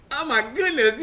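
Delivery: high, giggling disbelief; reliving a giddy gasp; giddy laughing. Retold or imitated. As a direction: laugh through the stammered shock. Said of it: "I got giddy".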